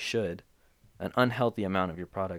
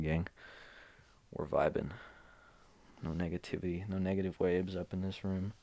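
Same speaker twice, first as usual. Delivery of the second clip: ordinary delivery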